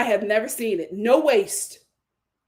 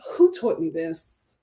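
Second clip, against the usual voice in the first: usual speaking voice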